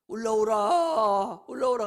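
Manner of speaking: loud, drawn-out summoning call. Acted. God calling sleepers to come up, loud drawn-out call. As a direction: call sleepers up at full voice, stretched long.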